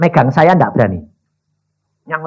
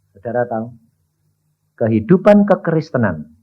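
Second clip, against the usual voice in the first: ordinary delivery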